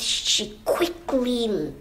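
ordinary delivery

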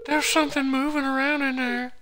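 very high, loud put-on kid voice; accented kid. Kid warning friend of movement in the sewer, high and loud. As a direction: raise the alarm in a high kid voice. Same kid in the game cutscene.

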